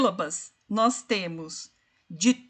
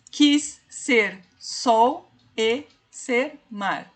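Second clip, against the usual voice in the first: "Deus o fez" slowly, one beat each; counting the meter slowly; slow scansion; much slower than usual. Shown as a demonstration. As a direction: spell out three syllables. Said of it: "Uma, duas".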